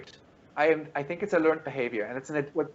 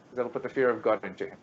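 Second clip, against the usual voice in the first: normal talking voice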